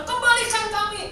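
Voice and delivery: high, loud, indignant grumbling; whining crowd complaint. Retold or imitated. Israelites complaining to Moses in the desert, high and loud. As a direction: moan at Moses as an ungrateful mob. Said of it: "Apa yang dia dapat, bangsa Israel?".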